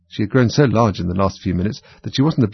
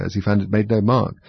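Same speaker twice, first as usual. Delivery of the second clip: ordinary delivery, nothing marked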